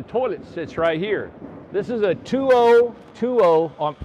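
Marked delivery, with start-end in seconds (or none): 1.7-3.7 called out loud and slow over the site noise. Real, not acted. Builder shouting the size to the camera, loud and slow. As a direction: project it across the room. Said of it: "I don't have to scream".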